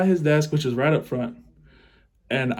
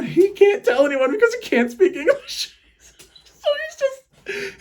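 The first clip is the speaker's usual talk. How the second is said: very high voice squeezed by laughter; real giggling fit mid-story; laughing helplessly; 4 dB louder than usual. Real, not acted. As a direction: struggle to get the words out laughing. Cracking up at own fart story.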